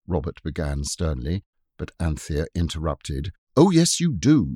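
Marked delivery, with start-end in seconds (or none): 3.6-4.5 put-on high, coaxing girl. A narrator's reading. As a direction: coax her gently like a big sister. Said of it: "she said coaxingly".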